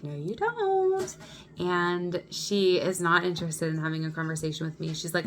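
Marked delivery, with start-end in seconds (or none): 0.0-1.2 much higher, slow, drawn-out no; insistent no. Retold or imitated. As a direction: stretch it out to put the cashier in her place. Arguing with cashier over bag.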